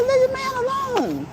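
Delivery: very high, slow teasing; teasing squeal. Retold or imitated. Older cousin teases the young girlfriend, very high and slow. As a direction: squeal it out playfully.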